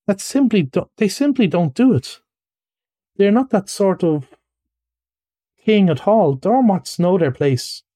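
high-pitched, peevish complaint; petulant, fussy indignation; pettish. Acted. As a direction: protest at the folly of it. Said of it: "said the mole quite pettishly".

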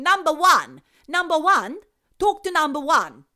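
put-on loud, high Italian-accented scolding; Italian ghost scolding. Ghost telling off the agent in an Italian accent. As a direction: jab each word in an Italian accent.

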